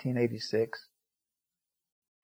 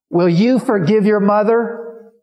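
urgent appeal; 9 semitones higher, 8 dB louder, slower than usual. Retold or imitated. Pleading with the woman.

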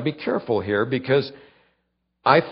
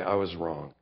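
softer and lower, plainly owning it; simple confession; 8 semitones lower, 10 dB quieter than usual. Pretend. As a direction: say it quietly, no excuses. Model confession.

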